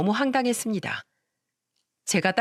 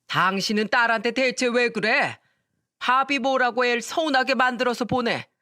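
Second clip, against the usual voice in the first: scolding; 4 semitones higher than usual. Acted. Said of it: "호통을 치며 말했습니다".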